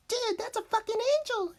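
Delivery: very high, excited squeal; awestruck kid. Retold or imitated. Kid spotting the best gun on the table, awestruck high voice. Kids awed by a top-end gun.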